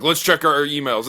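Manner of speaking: the host calling for questions, loud and slow; hyped bro announcement. Acted. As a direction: announce it like a pumped meathead.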